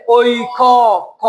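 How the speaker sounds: ঐক as "oi-kooo", the ko stretched long; drawn-out syllable demo. Shown as a demonstration. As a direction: hold the vowel after ক, then repeat it alone. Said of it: "ক এর এখানে কি উচ্চারণ হয়েছে?".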